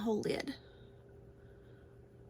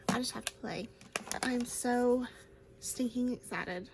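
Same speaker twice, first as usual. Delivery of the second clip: hushed eager whisper. Real, not acted. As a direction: whisper it like a secret treat. Excited whisper about new palette.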